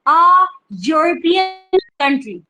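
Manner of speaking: आ European country, आ stretched slow with a yu sound; slow teaching read. Shown as a demonstration. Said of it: "हम इसको ई European नहीं पढ़ेंगे।". (धीरे से आ European, आ को खींचकर)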